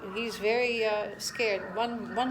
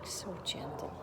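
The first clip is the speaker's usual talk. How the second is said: whispered and tender; soft whisper; 3 semitones lower, 10 dB quieter than usual. Real, not acted. Owner whispering praise over the gentle puppy. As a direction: breathe it softly to the puppy.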